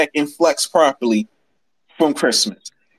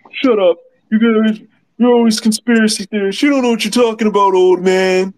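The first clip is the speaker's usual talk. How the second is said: mocking friends' voice; 7 semitones higher than usual. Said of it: "And I was like".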